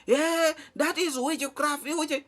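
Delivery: put-on high-pitched, slow old woman voice crying witchcraft; mock old woman shouting. Old woman at the fence accusing in a high voice. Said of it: "some old woman who was making noise, shouting".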